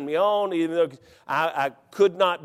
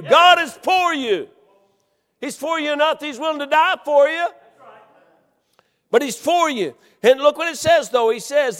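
loud, high preacher's shout; God is for you, shouted; full-force preaching; 8 semitones higher, 6 dB louder than usual. Acted. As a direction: proclaim it to the congregation.

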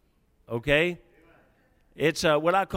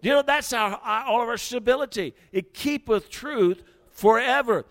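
higher and louder, building; fervent preaching; 6 semitones higher, 5 dB louder than usual. Acted. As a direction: drive it home to the church. Preaching at full force.

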